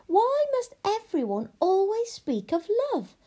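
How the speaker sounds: high, emphatic complaint; petulant princess. Acted. Princess stamps her foot at witch, petulant. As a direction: stamp out the words, pitched high. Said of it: "She stamped her foot".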